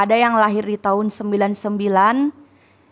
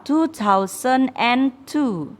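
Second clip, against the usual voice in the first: slow model reading; 3 semitones higher, much slower than usual. Shown as a demonstration. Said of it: "Dua ribu dua".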